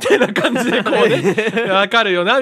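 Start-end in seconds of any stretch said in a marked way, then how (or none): 1.7-2.4 laughing, loaded with meaning; hint at it with a laugh; knowing hint. Retold or imitated. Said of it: "みたいな、そういう感じでね". (察しろと言わんばかりに)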